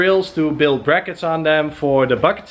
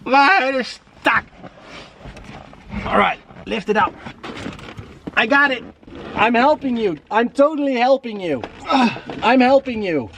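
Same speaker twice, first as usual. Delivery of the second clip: higher, strained under a heavy load; carrying heavy load; 8 semitones higher than usual. Real, not acted. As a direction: talk while lifting something heavy. Carrying seat out of car.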